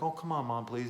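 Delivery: put-on high pleading child voice, lightly done; whiny kid. Boy begging his mom to go trick-or-treating, whiny plea. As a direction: beg like a kid wanting to go out. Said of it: "And he's like".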